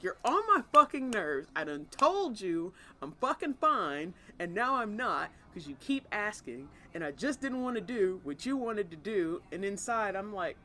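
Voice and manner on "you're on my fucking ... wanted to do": loud, high, exasperated venting; mock irritated rant. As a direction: rant like a partner who's had enough. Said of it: "that was the essence of".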